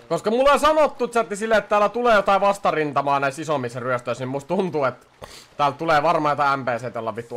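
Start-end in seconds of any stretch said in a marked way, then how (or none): none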